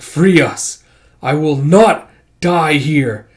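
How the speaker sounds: loud, emphatic refusal; desperate demand. Acted. Trapped character demanding to be freed, desperate angry demand. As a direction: insist slowly and forcefully at the end.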